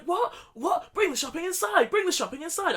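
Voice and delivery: lightly imitated angry boyfriend; imitating furious partner. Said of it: "you're so furious".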